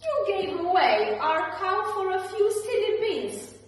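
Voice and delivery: loud, high angry scolding; shouted. A narrator's reading. Mother shouting at her son over beans. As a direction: yell at him for his foolishness. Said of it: "shouted Jack's mother".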